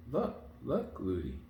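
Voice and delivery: put-on high, rising child's call; excited child. A narrator's reading. Princess calling her nurse's attention, high and excited. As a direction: point something out eagerly.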